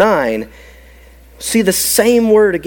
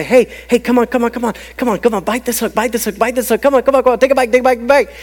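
higher, insistent rapid-fire coaxing; goading tempter; 5 semitones higher, much faster than usual. Acted. Satan luring someone to bite the hook, insistent goading. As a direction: egg them on, piling up the repeats. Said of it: "He's gonna say".

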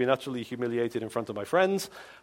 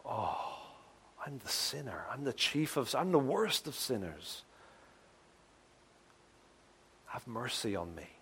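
hushed, softer, broken confession; a quiet cry of desperation; broken plea; 3 semitones lower, 8 dB quieter than usual. Retold or imitated. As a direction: barely whisper it, crushed. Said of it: "when God convicts you".

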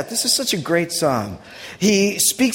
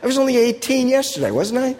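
higher, lightly voiced disbelief at growing old; a hint of mock amazement, pitched up; talking to the mirror; 8 semitones higher than usual. To your own aging face in the mirror, lightly mocking surprise. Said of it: "look yourself in the mirror and say".

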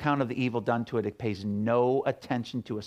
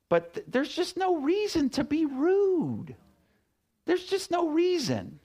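emphatic appeal. Real, not acted. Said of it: "preaching point".

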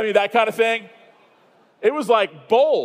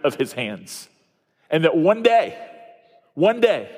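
preaching; 4 semitones lower than usual. Acted. Pastor urging his church toward hope, earnest preaching. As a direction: press the truth home to the congregation. Sermon closing exhortation.

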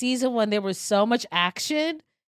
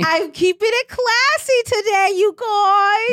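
loud and high; 11 semitones higher, 5 dB louder than usual. Real, not acted. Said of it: "Why do you have to say it so loudly?".

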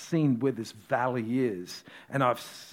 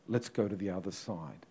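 the line said softer and lower, with weight; softer, meaningful; 7 semitones lower, 6 dB quieter than usual. Shown as a demonstration. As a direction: lean in and let it land quietly. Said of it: "There was a reason Jesus said".

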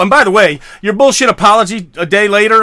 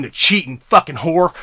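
ordinary talk

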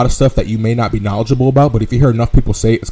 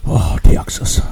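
lightly drawled Texan twang; mock Texan accent; 4 semitones higher, much slower than usual. Friend in a podcast chat puts on a mock Texan accent. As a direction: play a good ol' Texas boy. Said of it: "I love talking in this fucking accent".

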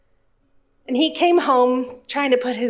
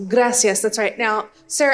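usual speaking voice

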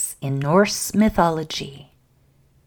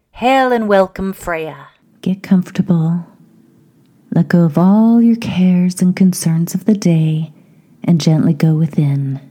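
measured meditation leader's voice; guided meditation; 8 dB louder than usual. Acted.

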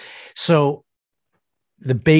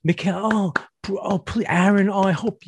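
higher fake-encouraging cheers; mock cheering from the back; 9 semitones higher than usual. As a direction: cheer them on without meaning it. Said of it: "they're like".

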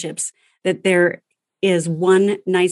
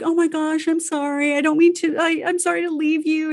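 mock guilty mom, pitched up; imitating a guilt-ridden mom apologizing to her son; 8 semitones higher than usual. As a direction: ham up the apologetic mother. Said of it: "'Cause you're like".

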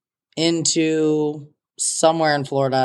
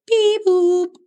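beep boop; 4 dB louder than usual. Retold or imitated. Boarding call sounds as plane leaves.

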